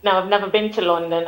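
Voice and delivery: ordinary talk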